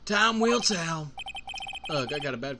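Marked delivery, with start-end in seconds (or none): 0.0-1.1 cowboy lawman. Acted.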